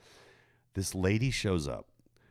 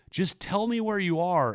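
high, insistent lady quote; 13 semitones higher, 4 dB louder than usual. Retold or imitated. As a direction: pitch up, insist kindly. Voicing the finder lady.

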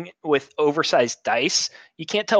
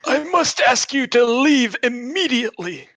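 put-on old man shouting; 11 semitones higher, 4 dB louder than usual. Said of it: "old man".

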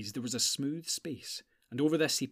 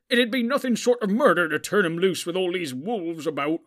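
higher and much louder protest; hot-headed hobbit defending his pony; 6 semitones higher, 11 dB louder than usual. A narrator's reading. Sam to Gandalf defending Bill, loud protest. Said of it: "protested Sam".

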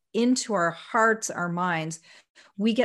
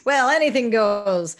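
mock shrug; 3 semitones higher, 8 dB louder than usual. Mock careless attitude.

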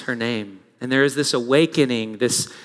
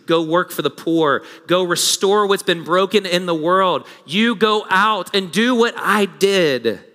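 building sermon commission; 2 semitones higher, 3 dB louder, slower than usual. Real, not acted. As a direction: charge the congregation, climbing with each "go".

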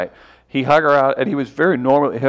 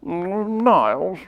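Frasier impression; 7 semitones higher, much slower than usual. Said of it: "That was good".

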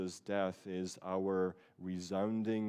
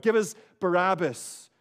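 crowd's demand; 8 semitones higher, 9 dB louder than usual. Retold or imitated.

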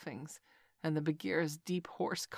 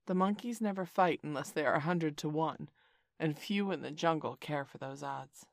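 usual speaking voice

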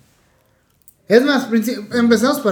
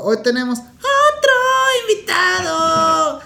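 hype intro; 10 semitones higher, much slower than usual. Acted. Host building up guest intro.

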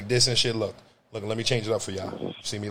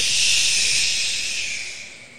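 shushing; 3 dB louder than usual. Real, not acted. Hushing the other.

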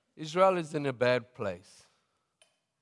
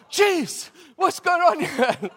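much higher and louder, laughing; laughing astonished shout; 11 dB louder, slower than usual. Retold or imitated. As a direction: shout it in stunned disbelief through laughter. Stunned reaction, laughing.

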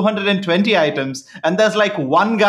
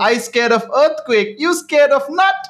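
much higher, mocking Chinese accent; Uncle Roger Chinese accent; 8 semitones higher than usual. Uncle Roger teasing a customer about nuts, in a high Chinese accent. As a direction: sneer at the nut allergy in a high Chinese accent. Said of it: "accent he keeps doing".

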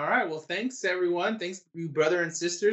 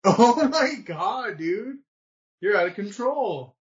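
laughing; 7 semitones higher, slower than usual. Real, not acted. Reacting to partner's laughing fit.

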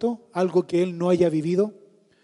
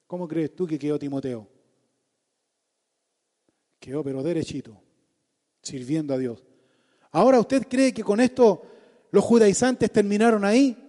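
ordinary talk

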